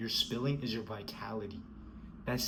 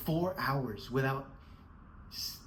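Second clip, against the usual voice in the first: normal talking voice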